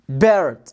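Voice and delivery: low, falling "بيرد" with an r-coloured vowel; no long "ay" in "بيرد", just the English R; correct English R. Shown as a demonstration. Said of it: "بنقول عليها يا مستر بيرد".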